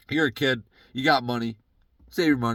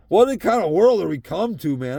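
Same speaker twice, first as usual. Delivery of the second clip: usual speaking voice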